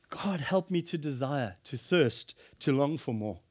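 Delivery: soft, low prayer; quiet inward plea; hushed prayer. Real, not acted. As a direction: pray it quietly, longing.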